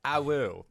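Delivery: short howl, loud and high; wolf howl. Shown as a demonstration. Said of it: "That is the noise that you hear".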